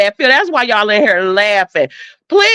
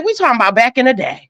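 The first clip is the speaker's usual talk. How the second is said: normal talking voice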